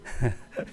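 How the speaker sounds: brief, breathy chuckle; quick amused chuckle; short chuckle. Real, not acted. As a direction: let out a short little laugh. Chuckles after voicing listeners' complaint.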